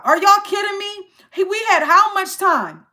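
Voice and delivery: can't believe what they're asking; exasperated disbelief. Real, not acted. Streamer ranting at chat's questions, exasperated.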